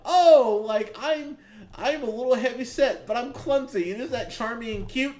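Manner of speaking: mocking a clumsy comedy heroine, pitched high; mock bubbly heroine. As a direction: ham up the clumsy charmer. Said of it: "being like".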